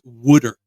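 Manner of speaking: "Wooder" with the vowel of "wood"; Philly "wooder". Shown as a demonstration. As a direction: say "Wooder" loudly, Philly style. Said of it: "W O O D E R".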